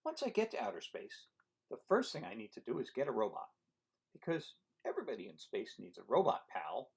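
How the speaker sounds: lively storybook read-aloud; reading to children. Acted. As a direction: read it to a room of kids. Reading picture book aloud.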